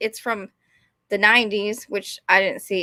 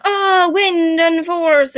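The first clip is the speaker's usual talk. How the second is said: slow, higher, melodramatic chant; put-on cartoon swoon, stretched long; mock swooning heroine; 5 semitones higher, 3 dB louder, much slower than usual. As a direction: drag the words out, hammed-up. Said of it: "They're like".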